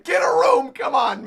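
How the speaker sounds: high, loud mock protest; heckling crewmates. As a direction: yell at the couple in exasperation. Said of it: "Roger and Gabon are like".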